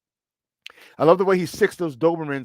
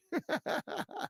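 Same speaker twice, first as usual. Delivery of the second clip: high giggle after the demo; amused laugh; 13 semitones higher, 13 dB quieter than usual. Real, not acted. Host laughing after his accent demo to co-host, high giggle. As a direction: crack up at himself.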